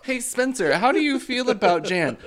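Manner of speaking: high, loud, gushing mock-host voice; cartoonishly loud and high back-and-forth with oneself; mock podcast hosts. One person acting both podcast hosts for laughs, hammed-up high voice. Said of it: "what people think about our podcast".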